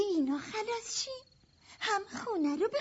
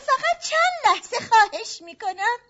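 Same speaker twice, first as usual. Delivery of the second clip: loud plea; 4 semitones higher, 10 dB louder than usual. Acted. Begging gangster for more time.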